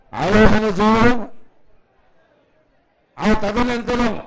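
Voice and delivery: loud announcing. Real, not acted. Announcer calling crowd to silence.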